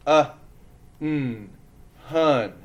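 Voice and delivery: "hun" built from separate sounds, Uh, mm, then blended; sounding out. Shown as a demonstration. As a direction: stretch out each sound of "hun", pausing between. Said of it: "Roller coasters ready?".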